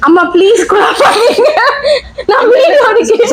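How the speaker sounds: high voice breaking up with laughter; squeaky, laughing words; real laughing fit. Real, not acted. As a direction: plead helplessly through real giggles. Breaks up laughing mid-recording.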